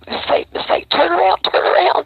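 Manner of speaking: retold warning, hissed and then called out high; urgent warning. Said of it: "she was like".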